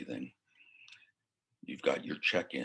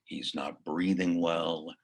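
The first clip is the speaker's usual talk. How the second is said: ordinary delivery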